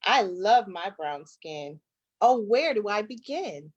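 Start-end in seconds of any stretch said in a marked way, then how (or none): none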